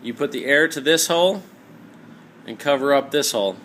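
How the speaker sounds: ordinary talk, nothing marked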